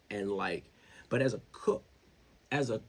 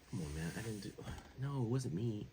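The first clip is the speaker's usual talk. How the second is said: softer, lightly mocked innocent denial; Aaron Rodgers; 9 dB quieter than usual. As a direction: play innocent, voice dropped back. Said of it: "Aaron Rodgers is the only person".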